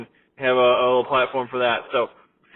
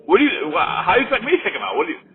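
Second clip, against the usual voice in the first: higher-pitched, mock exasperation; mildly exasperated protest; 8 semitones higher, faster than usual. Cashier answering demand to remove maskless customer, mock exasperated protest. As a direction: lightly act out a helpless worker objecting.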